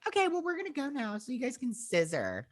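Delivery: high, soft teasing squeak; mock high voice. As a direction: tease them in a silly high voice. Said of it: "they're like".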